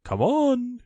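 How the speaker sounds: high loud call to the line. A narrator's reading. Christopher Robin calls the expedition forward, loud. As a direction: shout to those far back. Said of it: "called Owl".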